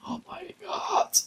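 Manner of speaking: whispered aside under the breath; fed-up whispered aside; exasperated whisper. Acted. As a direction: whisper it so he can't hear. Muttered aside about Bastian.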